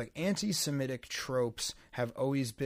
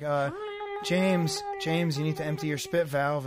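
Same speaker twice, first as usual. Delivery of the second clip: bad impression; 3 semitones higher, 4 dB louder than usual. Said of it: "That's a bad impression".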